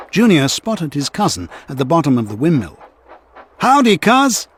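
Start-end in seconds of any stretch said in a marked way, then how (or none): 3.6-4.5 high folksy howdy; cheery cowboy greeting. A narrator's reading. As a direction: greet your cousin like a friendly cowpoke. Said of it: "Junior said".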